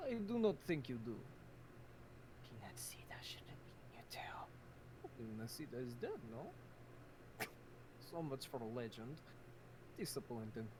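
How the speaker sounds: put-on deadpan Russian accent; coolly dismissive in Russian accent. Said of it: "the Russian accent is on point".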